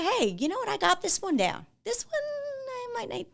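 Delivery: playful inner voice that rises high and drops in volume; voicing your inner self. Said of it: "Or you say".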